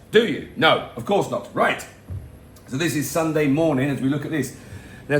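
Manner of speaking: ordinary talk, nothing marked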